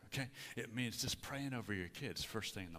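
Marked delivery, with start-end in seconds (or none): none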